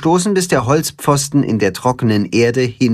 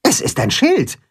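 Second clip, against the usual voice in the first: higher, calm hen voice, rising on Schild; hen character explaining; 9 semitones higher than usual. A narrator's reading. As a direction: state it plainly as the clever hen. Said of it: "sagt Timme".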